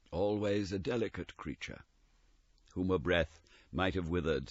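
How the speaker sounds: soft, hushed spirit voice; ghost's voice. Acted. The ghost telling Scrooge of his sister, softly.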